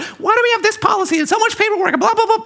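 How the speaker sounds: high, fast whiny teacher gossip; imitating a griping teacher in a squeaky rush. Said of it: "the teachers and the E As start talking".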